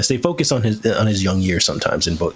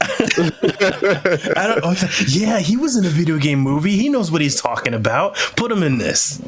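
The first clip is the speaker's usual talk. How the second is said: a light, higher voice lampooning a studio boss; mock executive; 8 semitones higher than usual. Mocking executives who cast Wahlberg, lightly voiced studio boss. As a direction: play the clueless producer, smug and sure.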